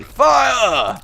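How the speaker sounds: high, loud mock battle shout; sudden hammed-up shout; shouted command. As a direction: yell it like an order to shoot. Calls out mock command in game.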